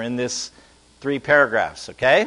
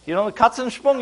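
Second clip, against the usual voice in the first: normal talking voice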